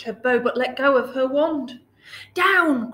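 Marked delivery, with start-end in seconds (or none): 2.3-2.9 loud, high command to land; witch's cry. A narrator's reading. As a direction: cry out the order from the broomstick. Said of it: "cried the witch".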